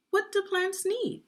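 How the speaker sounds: title sung out high on do; title read high. Shown as a demonstration. Teacher announcing the book title, high and bright. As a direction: lift the title up brightly for the class.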